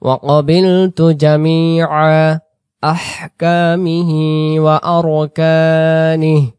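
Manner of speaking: slow, drawn-out chanted Arabic recitation. Shown as a demonstration. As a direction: intone each word slowly and reverently. Recited Arabic creed with music. (ধীর সুরেলা তিলাওয়াত)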